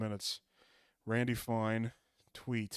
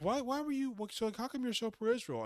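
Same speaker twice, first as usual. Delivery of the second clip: higher, lightly put-on smug questioner; a hint of a pompous interviewer, pitched up; mock Tucker Carlson; 8 semitones higher, 5 dB quieter than usual. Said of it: "it's like".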